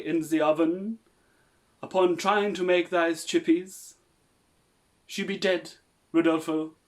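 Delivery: overdone dramatic messenger voice; mock-grave servant voice; hammy messenger. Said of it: "I'm gonna read aloud, uh, an excerpt".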